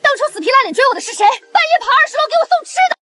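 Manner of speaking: high-pitched, heated outburst; indignant accusation. Acted. Scorned woman in acted quarrel. (尖声愤愤地质问)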